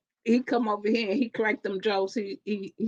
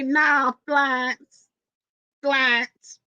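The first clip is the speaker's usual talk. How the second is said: Cajun drawl; 3 semitones higher, slower than usual. Retold or imitated. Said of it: "Louisiana drawl".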